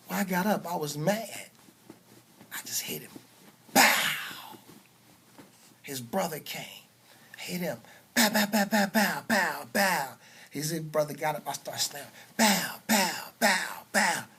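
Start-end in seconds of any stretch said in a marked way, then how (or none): 3.7-4.6 loud breathy mock punch noise; punch sound effect. Imitating a punch in fight story. 8.1-10.1 a loud string of mock punch noises; punch sound effects. Imitating rapid punches. 12.4-14.2 loud mock punch noises; punch sound effects. Imitating punches.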